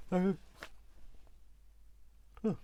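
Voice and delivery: talking through a yawn; words stretched by a yawn. Real, not acted. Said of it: "I don't know why I'm yawning".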